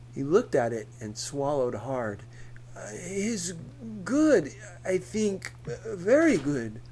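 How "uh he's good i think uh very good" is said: put-on higher, halting Spanish-accented man. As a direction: reassure her, flustered, in Spanish accent. Pool man answers, accented.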